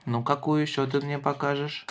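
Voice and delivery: normal talking voice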